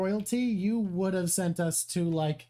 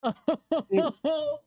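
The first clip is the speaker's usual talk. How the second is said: high, bright real laugh; a real burst of laughter at the sweet scene; delighted laugh; 9 semitones higher, 3 dB louder than usual. Real, not acted.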